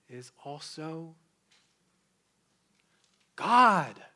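hold, then proclaim God; dramatic pause on God. Acted. Climactic reveal.

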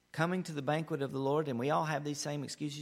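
ordinary delivery

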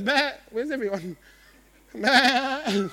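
a light bleat from a bewildered sheep; lost sheep bleating. Acted. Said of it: "he looks up and he goes".

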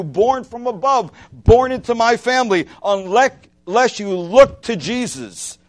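ringing sermon climax; fervent preaching. Acted. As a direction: preach it out with full conviction. Preacher rising to full force.

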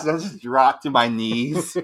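normal talking voice

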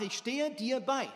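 ordinary delivery

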